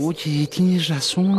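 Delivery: slow, high surprised realization. Acted. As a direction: stretch it out in surprise. Realizing they are lost. (sorpresa lenta y aguda)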